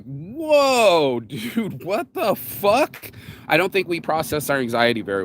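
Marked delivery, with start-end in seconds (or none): 0.0-3.1 mock startled. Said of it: "I was like".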